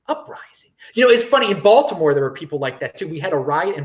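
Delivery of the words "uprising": breathed under his breath, no voice; voiceless sarcastic aside; whispered mock echo. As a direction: whisper the word back in scorn. Mocking her word for riot.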